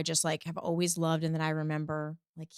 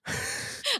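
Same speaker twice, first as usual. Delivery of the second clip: giggle. Real, not acted. Laughs at being teased.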